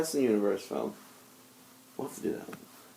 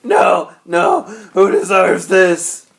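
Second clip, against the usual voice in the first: loud, slow, higher delivery; loud movie quote; 7 semitones higher, 8 dB louder, much slower than usual. Retold or imitated. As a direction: belt it out like the character. Quoting movie character.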